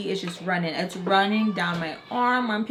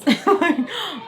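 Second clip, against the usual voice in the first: loud, high burst of laughter; amused laugh breaking out; 9 semitones higher, 7 dB louder than usual. Real, not acted. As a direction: crack up at your own words. Laughs at her own threat.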